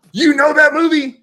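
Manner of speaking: very high, loud excited question; real excited shout; delighted shout. Real, not acted. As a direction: shout it, thrilled someone shares the love. Delighted that friend knows it.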